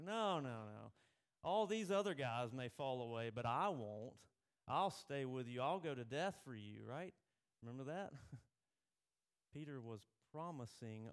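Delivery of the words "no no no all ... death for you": louder, insistent vow; boastful protest. Retold or imitated. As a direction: swear it over everyone's doubts. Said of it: "And Peter's like".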